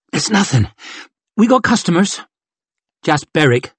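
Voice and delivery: a hint of hidden pain; brushing it off while hurting; lightly voiced hurt thief. Chev hiding her pain.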